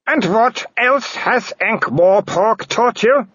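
high, cold aristocratic lady voice; a haughty noblewoman, accusing; werewolf baroness. Acted. Baroness scorns daughter.

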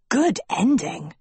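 a hint of a schoolboy character; lightly voiced Hort. A narrator's reading. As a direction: lightly put on another pupil's voice.

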